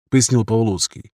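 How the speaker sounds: plain reading voice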